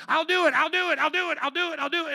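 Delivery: high, put-on eager chant; mock eager volunteering. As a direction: imitate someone jumping to volunteer. Imitating over-eager volunteers.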